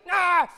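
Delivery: high, loud frustrated "Ah!"; angry yell. Acted. Hungry person yells in frustration after being robbed. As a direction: let out a furious cry.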